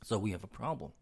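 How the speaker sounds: hoarse, fading raspy voice; losing voice. Real, not acted. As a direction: talk through a voice that is giving out. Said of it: "Sorry, I'm losing my voice here".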